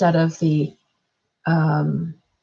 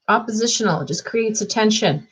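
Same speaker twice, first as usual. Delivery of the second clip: ordinary talk, nothing marked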